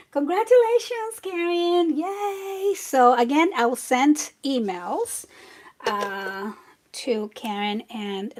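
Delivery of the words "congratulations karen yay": high, bright congratulations; cheerful. Real, not acted. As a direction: cheer the winner on.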